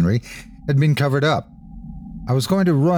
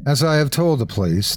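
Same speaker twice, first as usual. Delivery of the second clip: ordinary delivery, nothing marked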